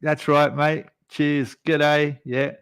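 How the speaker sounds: playing along. Australian in a pub faking understanding of strong accents, playing along. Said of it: "I'm just there going".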